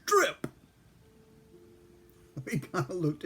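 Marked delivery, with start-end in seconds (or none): 0.1-0.5 sudden high, loud "Strip!"; barked drill command. Retold or imitated. Instructor shouting at new recruits, high loud bark. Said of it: "his first command".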